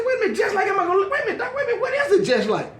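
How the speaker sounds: high-pitched, frantic interruption; mock alarm. Patient interrupting the doctor's diagnosis, high mock alarm. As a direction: cut in with rising comic panic.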